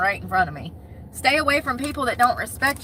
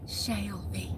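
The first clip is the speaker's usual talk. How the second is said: a softer, lingering name; romantic; 3 semitones lower, 13 dB quieter than usual. Pretend. Saying a viewer's name like a love novel hero, hushed. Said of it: "sounds like something out of a love novel".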